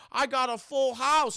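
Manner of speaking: loud, high, drawn-out crowing; mock gambler's boast. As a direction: call it out slowly and proudly. Retelling a gambler's happy announcement.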